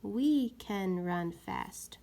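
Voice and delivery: we can run fast read slowly and firmly; slow clear trial reading; trying out a sentence. Shown as a demonstration. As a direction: read the right fit carefully. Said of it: "Let's try the word we".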